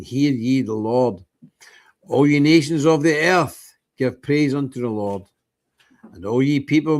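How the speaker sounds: slow, measured reading of a metrical psalm. Acted. Host reading Psalm 117 to his viewers, slow solemn reading. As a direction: read it out like a minister from the pulpit.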